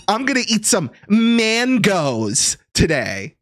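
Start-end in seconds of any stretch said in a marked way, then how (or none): 1.1-2.6 "mangoes" drawn out high with a flat a; overdone American "mangoes", slow and pitched up; exaggerated American pronunciation. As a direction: stretch it into "MAAAN-goes". Mock-correcting own pronunciation.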